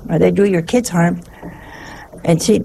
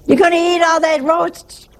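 high mock holler; 9 semitones higher than usual. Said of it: "Nobody's mad".

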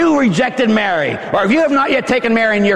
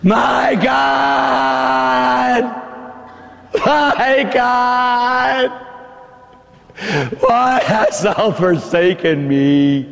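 half-sung anguished cry, then slow; Jesus crying out to his Father, anguished; 5 semitones higher, much slower than usual. Acted. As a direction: wail it like a forsaken son. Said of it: "Jesus Christ cried out".

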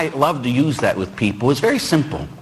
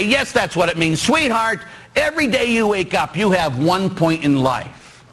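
fired-up insisting; 7 semitones higher than usual. Retold or imitated. Priest insists to bride.

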